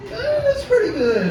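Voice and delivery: high-pitched, noncommittal "eh"; mock lukewarm shrug. Imagined modest college motto, high half-hearted approval.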